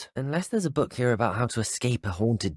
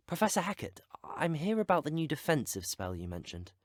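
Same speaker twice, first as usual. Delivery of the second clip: hushed; 5 semitones higher, 9 dB quieter than usual. Acted. Student asks professor about a new spell, hushed. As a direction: keep your voice right down.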